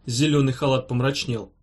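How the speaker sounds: usual speaking voice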